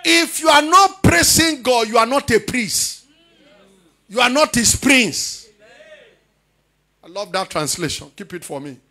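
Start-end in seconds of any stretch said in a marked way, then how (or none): 0.0-5.5 loud, high, slow proclamation; full-force preaching. Real, not acted. Preacher expounds the verse to his congregation, full-force preaching. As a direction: belt out each phrase, stretching key words.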